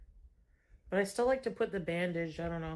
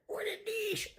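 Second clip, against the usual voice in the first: squeaky put-on voice; 13 semitones higher, 4 dB quieter than usual. Mocking the phrase in a tiny high voice.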